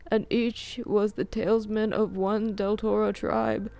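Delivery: put-on high, eager old man voice; an excited old scholar lecturing. A narrator's reading.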